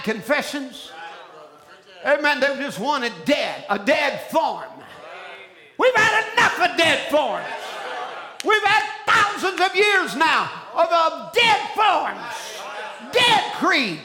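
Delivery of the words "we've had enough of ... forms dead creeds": high, driving revival-preacher shout; preaching at full force. Real, not acted.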